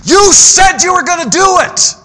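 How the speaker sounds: high, loud preaching shout; shouted rebuke. Acted. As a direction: hammer the promise home at full force. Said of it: "preacher pressing the congregation".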